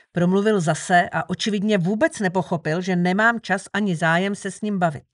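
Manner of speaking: plain reading voice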